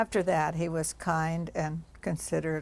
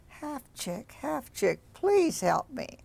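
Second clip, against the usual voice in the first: high, slow plea; a thin voice begging for help; pleading voice; 7 semitones higher, slower than usual. Acted. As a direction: wind trapped in branches pleading.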